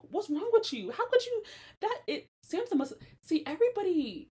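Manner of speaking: high, loud, incredulous rant; outraged rant. Real, not acted. Ranting at the father who gave away the wife, high and outraged. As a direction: demand answers, heated.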